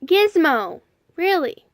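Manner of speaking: loud, high exasperated call at the pet; sharp telling-off. Real, not acted. Calling out to the dog Gizmo, loud and exasperated. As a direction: snap the name out to stop the dog.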